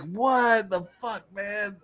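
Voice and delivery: high, slow, fading dismay; dismayed disbelief. Retold or imitated. Viewer losing Top Gun, slow high dismay. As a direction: drag it out in dismay. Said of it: "you're like".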